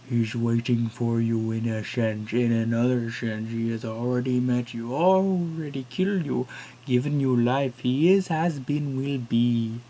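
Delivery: put-on cryptic old man. Strange old man's prophecy.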